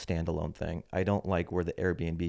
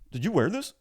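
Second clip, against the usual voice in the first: high-pitched mock interrogation; grilling clerk; 10 semitones higher, 3 dB louder than usual. Clerk grilling customer over return, mock suspicious. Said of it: "he's, like, grilling me from the jump".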